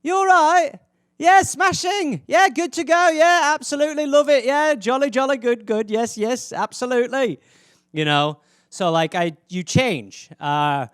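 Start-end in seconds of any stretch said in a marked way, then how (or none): 0.0-7.4 high, loud, jolly British phone voice; hammed-up British chirping, pitched way up; put-on British accent. Imitating himself on the phone to family, jolly British accent. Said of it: "my accent changes when I talk to English people".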